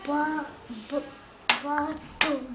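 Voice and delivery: Ba-, ba- split before bateau; syllable by syllable. Shown as a demonstration. Child showing syllables, saying bateau in parts. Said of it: "deux syllabes".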